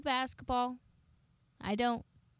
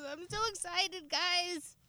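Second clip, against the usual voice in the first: mock excited voice; 8 semitones higher, slower than usual.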